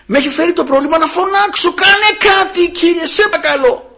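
high, urgent pleading call; crying out in prayer. Acted. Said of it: "φωνάει, φωνή δυνατή". (ικετευτική κραυγή)

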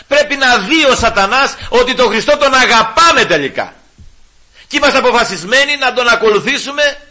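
preaching full force to the congregation; fiery preaching. Acted. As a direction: urge the faithful never to give up.

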